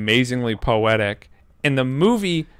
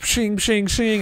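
wings snapping open; 9 semitones higher, slower than usual. Retold or imitated. Wings popping open sound.

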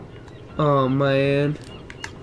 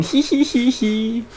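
giggle; 9 semitones higher, slower than usual. Pretend. Gloating after hit and run.

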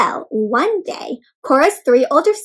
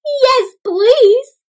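excited yes please; 12 semitones higher, 8 dB louder than usual. Acted. Girl excited about apron.

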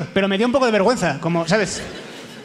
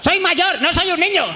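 high-pitched pleading yell, acted out; raised voice; 12 semitones higher, 3 dB louder than usual. Mistaken for a child, calling to the teacher, high yell. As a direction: strain high to be heard over a crowd. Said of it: "intentando como alzar la voz".

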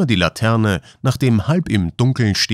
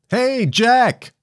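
very high, drawn-out call; 14 semitones higher than usual. A narrator's reading. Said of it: "rief er hinab". (hoch hinabgerufen)

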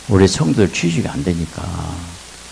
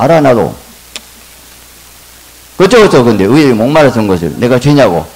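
much higher and louder, laughing preacher's cry; full-force preaching; 5 semitones higher, 8 dB louder than usual. Acted. Pastor answering elders' mockery, preaching loud and high. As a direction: preach it out loud over the crowd. Defends his vision to congregation.

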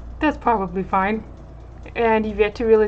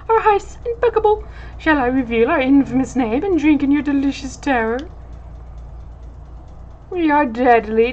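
pompous, high-pitched gentleman rogue; haughty dandy villain gloating; dandy villain captain; 6 semitones higher, 6 dB louder than usual. Acted. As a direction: preen like a theatrical villain.

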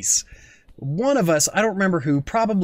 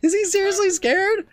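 high, incredulous squeal; can't believe he's scared of a goose; amused disbelief; 14 semitones higher than usual. Real, not acted.

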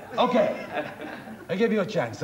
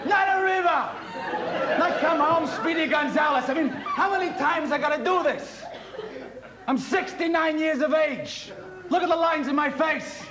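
high-pitched angry tirade; exasperated rant; 7 semitones higher than usual. Acted. Conductor explodes after wrong word.

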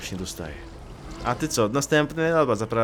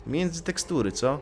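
usual speaking voice

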